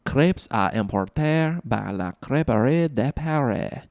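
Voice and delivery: slow, exaggerated French accent on the name; jokey "spot-on" French accent. Shown as a demonstration. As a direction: draw out the French name with mock-expert French pronunciation. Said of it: "that is not an offensive accent".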